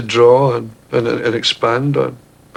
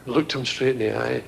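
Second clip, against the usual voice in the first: usual speaking voice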